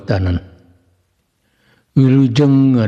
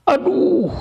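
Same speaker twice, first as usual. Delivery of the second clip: sudden high yelp of pain, cut short; a high, sharp yelp as the club hits; cry of pain. Acted. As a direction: shriek it like a blow just landed. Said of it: "ngagoak bakat ku nyeri".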